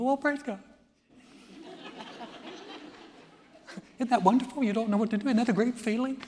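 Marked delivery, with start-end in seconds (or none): 0.0-0.6 much higher, put-on cheery parent voice; hammed-up sing-song praise; mock cheerful parent. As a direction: chirp it like a smug parent. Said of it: "And you go".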